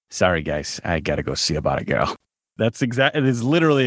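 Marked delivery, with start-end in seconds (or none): 0.1-2.1 put-on low-pitched Boston accent. Actor obliging the host's request, low Boston accent. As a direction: drop much lower into a thick Boston accent. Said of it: "in a Boston accent".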